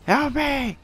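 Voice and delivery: high desperate cry for help; panicked scream. Real, not acted. Dying, calling for help.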